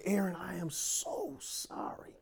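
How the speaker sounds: hushed, mortified sorry; whispered apology. Retold or imitated. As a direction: breathe the apology out in shame. Embarrassed apology after the slip.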